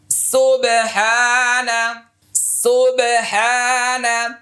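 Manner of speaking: সুবহানা slowly drawn out, each vowel held; drawn-out recitation. Shown as a demonstration. Teacher modelling সুবহানা slowly for the class. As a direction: chant সুবহানা slowly for learners. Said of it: "এই তাসবিহটা এখন বলছি".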